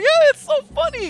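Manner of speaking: very high, loud, thrilled squeal; a kid cracking up at the name; delighted daughter. Retold or imitated. Daughter laughing in story.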